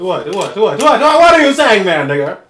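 rapid repeats building to a high, loud wail; hammed-up shock, climbing high; mock disbelief. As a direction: stammer it over and over, then erupt. Mock shock at slavery remark.

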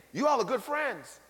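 ordinary talk

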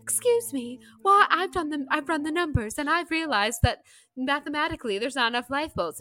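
hammed-up heroine raising an alarm; acted Titanic heroine. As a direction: pipe up high to challenge him. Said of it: "the scene where she's like".

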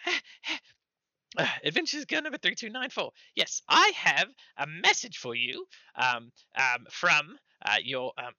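high, loud, faltering gnome voice; squeaky gnome messenger. Acted. Gnome delivering a message, squeaky and faltering. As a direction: squeak out an official message, stumbling. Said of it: "a high squeaky voice".

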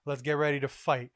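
plain reading voice

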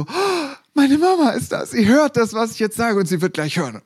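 put-on high, loud thrilled child's thought; excited. Small boy on stage realizing mom is watching, excited. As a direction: burst out with delight at hearing mom.

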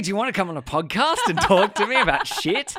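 loud, high, overexcited mock chirp; excited. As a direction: gush like a keen networker. Mock eager networking quote.

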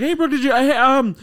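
high, loud, fake-casual change of subject; high awkward cover-up. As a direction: cover for her by chatting up the driver. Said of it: "I was like".

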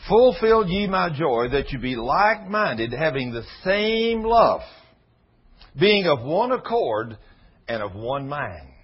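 slow, loud preaching of the verse; preached scripture. Acted. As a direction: proclaim the verse, word by word. Reads scripture with force.

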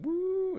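high, loud "woo"; imitated crowd whoop. Imitating loud friends heard on videos, high whoop. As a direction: whoop like an extra loud fan. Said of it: "some extra loud people".

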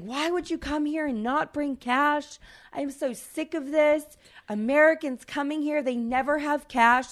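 high, loud furious rant; mock-yell like an irate Paris cabbie; angry cabbie. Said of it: "this guy was legitimately, like, pissed".

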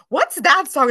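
imitating kids demanding an answer, high and loud; kids' loud question. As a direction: blurt it out like nosy kids. Kids at work overheard her singing.

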